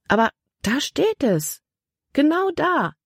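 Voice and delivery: indignant. A narrator's reading. Libby points accusingly at the paper. (empört, mit hochschnellender Stimme)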